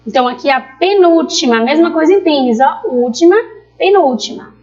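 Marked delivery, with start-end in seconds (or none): none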